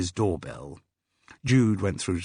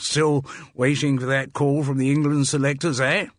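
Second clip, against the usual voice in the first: higher, hearty public school banter; hearty Mike, jovial schoolboy type; 5 semitones higher, 4 dB louder than usual. Acted. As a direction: joke about yourself with booming public school bonhomie. Mike jokes about his cricket team.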